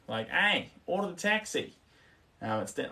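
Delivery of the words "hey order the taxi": throaty Australian accent, pitched up; mock Australian accent. Shown as a demonstration. Streamer showing viewers how Australians speak, throaty Australian accent. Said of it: "sort of the throat is what I'd say".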